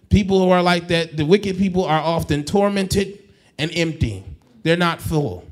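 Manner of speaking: loud, driving preacher's plea; full-force preaching. Acted. Pastor pleading with the congregation, preaching loud. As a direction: lift it up and push the congregation.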